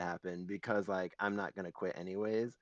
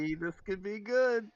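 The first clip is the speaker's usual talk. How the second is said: high, louder hopeful lilt; hopeful thought in a raised, high voice; 12 semitones higher, 5 dB louder than usual. Acted. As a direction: pipe up with optimistic hope. Acting out their own hopeful attitude.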